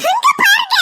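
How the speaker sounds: high-pitched startled call; alarmed cry. Acted. Alarmed cry to look at flood. (பதறிக் கூவும் குரல்)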